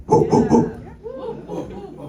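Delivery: chanted crowd woo; hooting Arsenio crowd cheer; Arsenio Hall woo. Retold or imitated. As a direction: whoop it like an Arsenio Hall audience. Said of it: "That's an Arsenio Hall reference".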